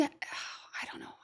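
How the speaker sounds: a sigh into a whispered give-up. Real, not acted. To the viewers, stuck on the lyrics: sighing whisper. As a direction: breathe it out, defeated.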